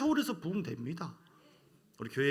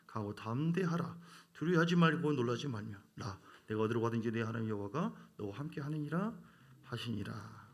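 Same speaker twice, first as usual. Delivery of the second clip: scripture reading; 5 semitones lower than usual. Acted. Reads the Bible passage aloud.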